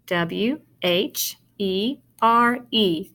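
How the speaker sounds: slow "W H E R E" with a gap after each letter; spelling aloud. Shown as a demonstration. Teacher spelling where again for pupils, spelling aloud. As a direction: space out every letter of "W H E R E". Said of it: "Let's spell it".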